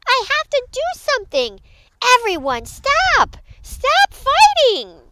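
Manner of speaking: high, urgent shouting; small creature's frantic high cry; desperate plea. Acted. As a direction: yell over a brawl to stop it. Pip pleads to end fight.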